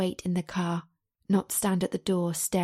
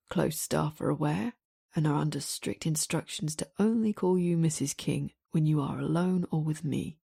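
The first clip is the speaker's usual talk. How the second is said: deep male voice; 4 semitones lower than usual. A narrator's reading. Damien voiced, lower.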